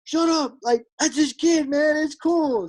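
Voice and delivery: high, slow, emphatic rowdy fan. Retold or imitated. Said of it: "he's like".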